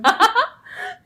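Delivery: high squealing laugh; big laugh. Real, not acted. Laughing hard at the lost-at-sea story, high laugh.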